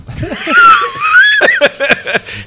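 high loud burst of laughter; shouting laugh. Real, not acted. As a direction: roar with laughter. Big laugh after joke.

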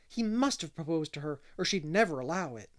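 ordinary delivery

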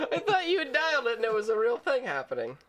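fast, high, laughing through the words. Real, not acted. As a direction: crack up while explaining the mistake. Laughing at own mistaken belief.

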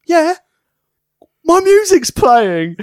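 pointedly slow and high; slow, high, loud drawl. Retold or imitated. Partner telling a shouting wrestler his music is on, slow and high. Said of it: "And Jacob's like".

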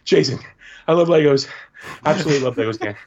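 lightly voiced gushing child; little boy impression. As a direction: imitate an excitable young fan. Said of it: "this little boy".

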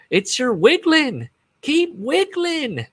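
very high, slow, stretched-out mock whisper-croon; high drawn-out mock voice. Bandmate coaxing [name] on stage, very high and slow. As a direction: draw out each word way up high, teasing. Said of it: "but he goes".

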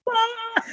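soft, high "ah" of mock panic; small squeal of pretend exasperation; mock overwhelmed cry. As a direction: imitate a quiet, high wail. Imitating staff's overwhelmed reaction.

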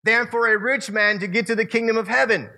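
high, playful Jesus teasing with a dad joke; jokey dad joke. Retold or imitated. Jesus teasing the disciples, jokey dad-joke voice. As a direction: throw in the joke with a grin. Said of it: "jokey dad joke".

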